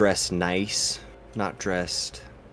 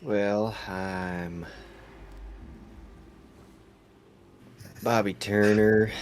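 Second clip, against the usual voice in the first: put-on Southern drawl, slightly higher; Southern accent; 3 semitones higher, slower than usual. Player introducing his sailor character, Southern accent.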